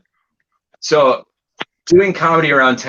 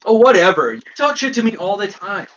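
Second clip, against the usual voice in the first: higher, louder, whiny mock reply; mocking the friend; 10 semitones higher, 4 dB louder than usual. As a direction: put on the friend's petty whine. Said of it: "Seriously, like".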